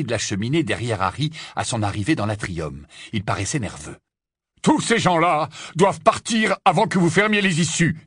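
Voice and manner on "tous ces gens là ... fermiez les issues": authoritative. A narrator's reading. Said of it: "avec toute l'autorité dont il était capable".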